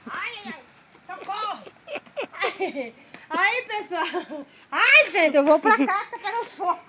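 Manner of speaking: panicky yelps. Real, not acted. Corn burning, cries for help.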